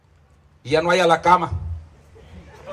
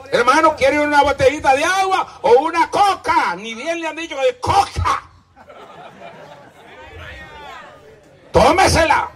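mock offer; 8 semitones higher than usual.

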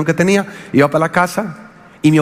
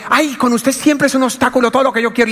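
wife's complaint; 7 semitones higher than usual. Retold or imitated. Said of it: "Cuando dijo".

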